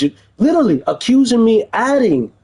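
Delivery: incredulous flare-up at the accusation; indignant outburst. Real, not acted. At the detective's drug accusation, real indignant outburst.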